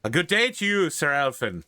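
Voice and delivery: high, lilting Irish greeting; very Irish accent. Acted. Irish priest greets a knight, very Irish accent. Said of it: "in a very Irish accent".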